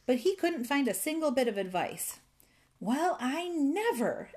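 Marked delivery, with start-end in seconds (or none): 2.8-4.3 higher, drawn-out astonishment; indignant exclamation. A narrator's reading. Fairy gets no advice at all, exclaiming indignantly. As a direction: exclaim it slowly, pitch lifted, scandalized. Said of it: "exclaimed the fairy".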